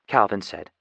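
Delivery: ordinary delivery, nothing marked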